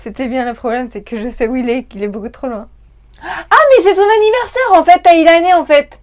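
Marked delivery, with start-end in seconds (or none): 3.2-6.0 loud, high, delighted outburst; excited realisation. Real, not acted. Realising it is the viewer's birthday, loud and excited. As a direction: burst out with the sudden discovery.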